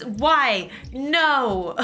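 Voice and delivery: ordinary talk, nothing marked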